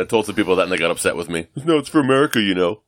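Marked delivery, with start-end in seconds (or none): 1.6-2.8 a hint of a dopey indignant guy; mild mock of an annoyed neighbor. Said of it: "They all have that voice, too".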